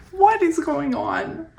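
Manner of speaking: high, slow, disbelieving exclamation; astonished. Real, not acted. Reader reacts to an uncanny card, astonished. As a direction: draw it out in high-pitched amazement. Amazed at the card's meaning.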